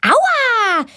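high cry of pain; yelp of pain. A narrator's reading. Girl yelping in pain when the boy pulls her leg. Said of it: "schreit Valentina".

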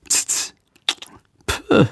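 quiet tut-tut and a huffy high pö; scoffing tutting. A narrator's reading. Little bat Sándor scoffing at the grown-up's remark.

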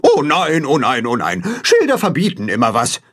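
lightly voiced bleating goose complaint; Gram, grumbling. A narrator's reading. Goose Gram complains about signs, grumbling. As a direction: moan in a falling run of dismay. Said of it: "meckert Gram kopfschüttelnd".

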